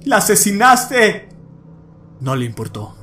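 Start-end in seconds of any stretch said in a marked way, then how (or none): 0.0-1.3 shout. A narrator's reading. Son accusing father of murder.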